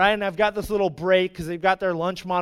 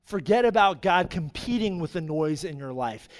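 softening, lower sermon close; hushed earnest preaching; 4 semitones lower, 4 dB quieter than usual. Acted. Preacher urging people to listen for God, gentle earnest preaching. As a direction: let each phrase settle quietly, with long pauses.

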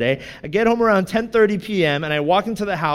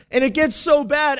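ordinary delivery

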